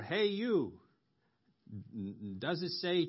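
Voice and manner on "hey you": higher, louder hail; calling out. Retold or imitated. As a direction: call to get someone's attention. Acts out alternative calls.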